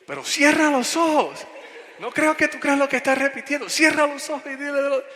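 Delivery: very high, shouted mock command; bien bravo. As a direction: order him to pray, acting the fierce youngster. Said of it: "bien bravo". (orden gritada y brava, imitando al jovencito)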